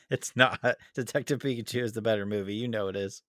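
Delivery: ordinary delivery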